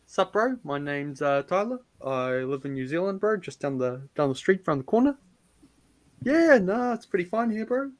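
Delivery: soft, casual Kiwi accent with rising ends; lay-back Kiwi bloke introducing himself; typical New Zealand accent. Shown as a demonstration. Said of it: "if you want a typical New Zealand accent".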